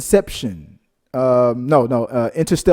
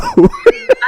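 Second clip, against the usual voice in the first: loud burst of laughter; 10 dB louder than usual. Real, not acted. Reacting to a comment.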